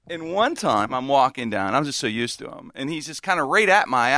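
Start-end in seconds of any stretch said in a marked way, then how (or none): none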